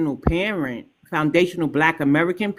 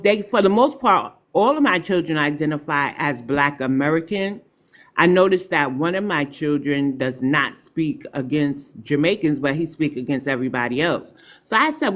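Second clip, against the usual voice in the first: ordinary talk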